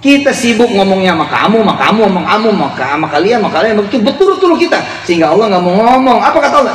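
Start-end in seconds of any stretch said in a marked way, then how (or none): none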